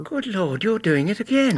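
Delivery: high, half-laughing astonishment; husband startled by his wife's Holmes-like guess; amazed exclamation. Acted. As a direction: marvel at her deduction.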